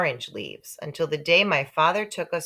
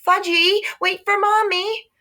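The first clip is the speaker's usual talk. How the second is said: put-on very high, loud calling after a child; mother hollering; 6 dB louder than usual. Mother chasing Fudge in the park, high loud holler. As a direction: holler after the runaway toddler.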